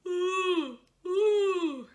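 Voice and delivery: loud high yawning ooh; yawn sigh. Shown as a demonstration. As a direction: open the throat and yawn. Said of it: "You've gotta make a yawn".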